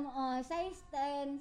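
slow, soft intercom announcement; imitated intercom call. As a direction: murmur it drawn out into a shop intercom. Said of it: "may intercom".

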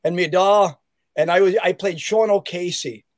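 attempted Irish accent with a lilt; loose, slightly wandering Dublin Irish accent. Shown as a demonstration. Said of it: "we need to hear this Dublin accent".

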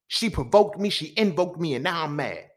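loud, high angry outburst; heated rant. Retold or imitated. Explaining his rage to his mom, loud and heated. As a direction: rant like it's happening again.

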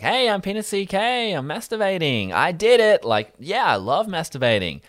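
high, shouty mock stage character; Penis C K. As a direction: crow it like a clownish comic. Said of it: "goes into the Penis C K".

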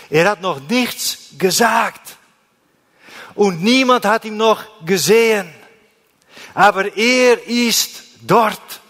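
high, loud, ringing preacher's proclamation; preaching at full force. Acted. Preacher lifting up the congregation, loud and high-pitched. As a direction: proclaim it to the hall, voice lifted high.

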